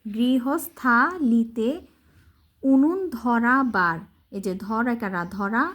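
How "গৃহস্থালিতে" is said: গৃহস্থালিতে slowly, গৃহস্থা then লিতে; slow split word. Shown as a demonstration. Teacher reading a long word piece by piece. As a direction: read গৃহস্থালিতে slowly in two parts. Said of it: "এইটুকু আলাদা উচ্চারণ করবা".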